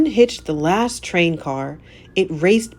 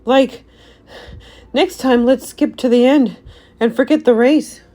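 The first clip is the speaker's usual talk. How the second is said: high, louder out-of-breath Shaggy; panting; 6 semitones higher, 6 dB louder than usual. A narrator's reading. Shaggy after the bull run, breathless and high. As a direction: gasp it out after running. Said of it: "panted Shaggy".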